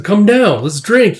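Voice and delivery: calling out. Retold or imitated. Comrade calls him to celebration.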